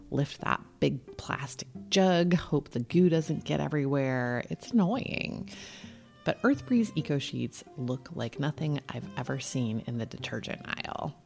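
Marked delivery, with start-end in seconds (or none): none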